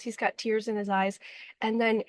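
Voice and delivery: ordinary talk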